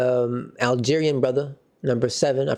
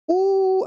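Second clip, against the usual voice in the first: sudden high, loud squeal of pain-by-proxy; 4 dB louder than usual. Real, not acted. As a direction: yelp as if you felt the tackle yourself. Pained reaction to a bad tackle.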